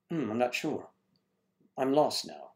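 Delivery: usual speaking voice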